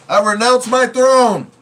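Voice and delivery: high, loud, slow royal proclamation; hammed-up regal quote; movie line impression. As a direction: declare it grandly like a prince. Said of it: "he said".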